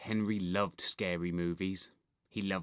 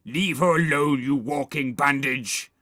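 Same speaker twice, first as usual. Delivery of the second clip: loud, forceful monster voice; menacing werewolf; 4 semitones higher, 7 dB louder than usual. A narrator's reading. Werewolf confronting bandaged boy, loud threat. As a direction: challenge him loudly.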